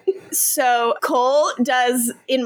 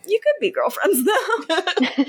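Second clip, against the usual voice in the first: laughing; 6 semitones higher than usual. Real, not acted. Teasing chat suggestion.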